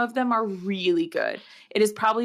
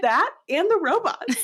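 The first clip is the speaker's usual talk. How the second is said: much higher, put-on airy friend voice; ditzy friend; 9 semitones higher, 4 dB louder than usual. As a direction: play it as a cheerfully clueless friend, pitched way up. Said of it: "And she goes".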